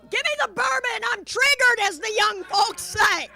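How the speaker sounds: high, loud, theatrical shout; triggered outburst. Acted. Comic shaken by what was seen, high shouted outburst. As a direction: holler it out in mock shock. Acted shock after the bedroom sight.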